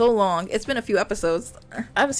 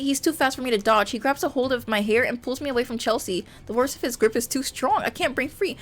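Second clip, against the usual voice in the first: usual speaking voice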